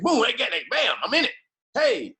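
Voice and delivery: high-pitched burst of action words; excited mock pounce. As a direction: act out cops suddenly jumping in. Acts out cops jumping into action.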